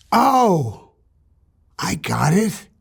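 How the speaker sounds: usual speaking voice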